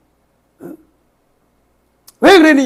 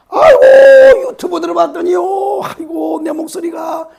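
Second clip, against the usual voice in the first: hammed-up anguished cry, high and loud; mock crying shout, much higher; mock wail; 9 semitones higher, 7 dB louder than usual. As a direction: wail in comic despair over your own voice. Mock lament about own voice.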